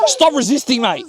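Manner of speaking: high Aussie cop command; Aussie accent. Shown as a demonstration. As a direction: show the Aussie cop delivery. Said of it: "You gotta get into character".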